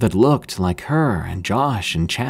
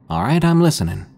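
eager, slightly higher reply; a bit excited; 6 semitones higher than usual. Retold or imitated. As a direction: sound keen to hear the story. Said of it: "kind of excited".

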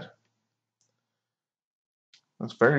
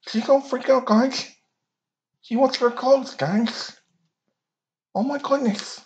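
excited; 13 semitones higher than usual. Real, not acted. Excited hype about coming scene.

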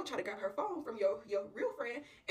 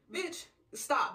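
higher, louder command to quit; sharp rebuke; 6 semitones higher, 5 dB louder than usual. Acted. Real friend halting the tactless friend, cross. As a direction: cut her off loudly.

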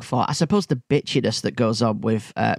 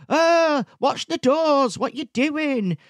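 mocking yell at kids; 12 semitones higher, much slower than usual. Mimicking the Land Rover dad shouting at his four kids, high mock yell. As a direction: shriek at the kids as he flings doors open. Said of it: "you hear him go".

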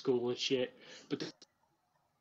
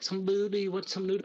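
Buck Loud impression; 6 semitones higher, 5 dB louder than usual. Redoing his Buck Loud bit, higher and louder. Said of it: "I did the Buck Loud".

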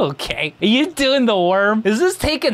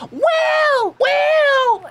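high, desperate calling out; frantic shout; 4 dB louder than usual. Retold or imitated. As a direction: call the name in panic. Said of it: "she's like".

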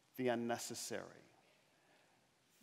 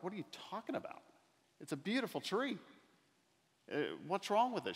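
higher, incredulous "what are you talking about"; disbelieving protest; 9 semitones higher, faster than usual. Retold or imitated. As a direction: protest the tree is fine, in disbelief. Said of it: "And I looked at her and I'm like".

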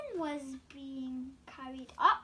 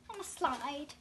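usual speaking voice

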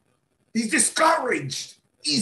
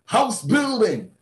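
ordinary delivery

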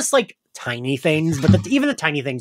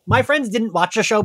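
normal talking voice

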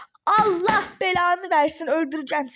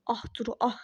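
low, soft, weary lament; dejected muttering; 7 semitones lower, 8 dB quieter than usual. Acted. Ex-husband laments his embarrassment to himself, low and dejected. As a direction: mutter to yourself, humiliated. Ali alone, humiliated after the row.

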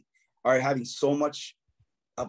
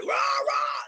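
mock rock yell in a rough throaty voice; imitating yelling singers. Mocking screamo bands to the host, a rough mock yell. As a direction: ham up a dudes-screaming band. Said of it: "and just yelling".

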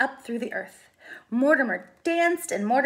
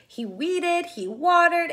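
ordinary delivery, nothing marked

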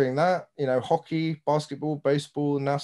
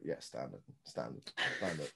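much lower, much softer throwaway; casual low murmur of the slang word standard; muttered catchphrase; 11 semitones lower, 16 dB quieter than usual. Retold or imitated. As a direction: mumble it down low and soft. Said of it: "I was just like".

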